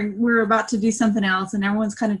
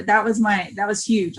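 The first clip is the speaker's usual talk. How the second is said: ordinary talk, nothing marked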